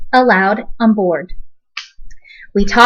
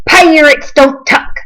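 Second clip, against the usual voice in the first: louder, slowish pirate growl; gruff captain refusing a child; firm pirate refusal; 5 semitones higher, 5 dB louder than usual. A narrator's reading. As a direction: lay down the pirate law.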